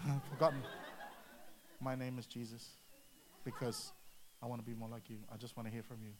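low, soft murmured plea to God; private prayer. Acted. Praying to God for what to say next, quiet humble prayer. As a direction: pray under your breath, pitched well down.

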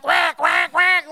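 loud high quacking squawk; mock bird squawk. Pastor mocking Queensland birds, loud squawking. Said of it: "In Queensland".

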